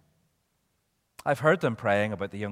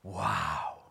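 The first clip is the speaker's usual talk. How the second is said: low, hushed wow of admiration; awed wow; 7 semitones lower, 6 dB quieter than usual. Retold or imitated. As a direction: breathe out an awestruck, low wow. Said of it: "And he went".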